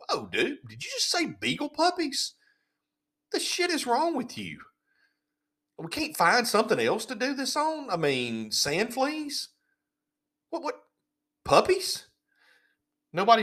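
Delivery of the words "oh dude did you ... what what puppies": mock outraged voice pitched way higher; incredulous colleague. Imagined lab coworker objecting to puppy tests, high mock outrage. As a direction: squeal it like a shocked coworker who can't believe it. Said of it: "should have gone".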